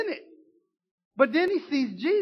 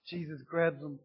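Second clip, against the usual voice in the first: ordinary talk, nothing marked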